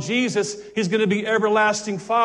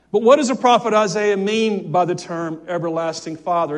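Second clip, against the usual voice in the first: ordinary talk, nothing marked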